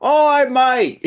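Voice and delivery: high, loud, slow mock Australian; hammed-up Australian holler with a laugh; horrible Australian accent. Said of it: "That's my horrible, horrible Australian accent".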